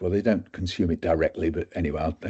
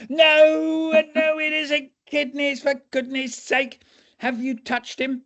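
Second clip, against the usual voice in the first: exasperated doctor; slower than usual. Retold or imitated. Doctor scolds students, high and exasperated. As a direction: despair at the students.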